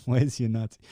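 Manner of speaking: normal talking voice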